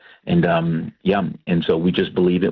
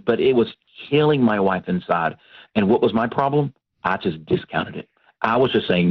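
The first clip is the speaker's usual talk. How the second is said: normal talking voice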